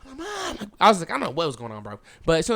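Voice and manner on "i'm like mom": mock yell. Storyteller acts out seeing his mom taken, hushed mock yell. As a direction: yell for Mom with the volume held down. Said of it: "I think I started yelling".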